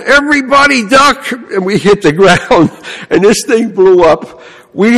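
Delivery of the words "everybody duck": retold warning yell. Pinhead warns the kids before the can blows, mock yell. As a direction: imitate a boy's sudden high warning shout. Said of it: "he yells out".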